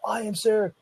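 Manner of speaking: put-on high-pitched, eager kid answering the instructor; eager student. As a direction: pipe up high like a keen student. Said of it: "as if they all wanna be instructors".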